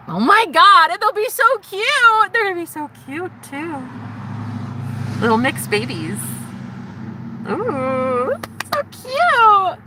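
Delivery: high-pitched squeal of delight; high, thrilled gushing; gushing excitement. Real, not acted. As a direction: coo and squeal over cute babies. Gushing over imagined future babies.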